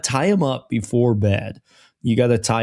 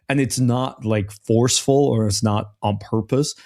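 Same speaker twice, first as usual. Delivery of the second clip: ordinary talk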